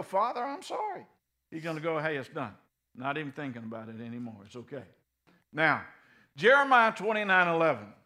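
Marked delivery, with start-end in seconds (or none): 0.0-1.1 high, small voice saying sorry; a meek penitent's high plea; sorry sinner. Retold or imitated. 2.0-4.9 soft, low, quick and fading; tender father brushing it off; gentle reassurance. Retold or imitated. God voiced reassuring sinner.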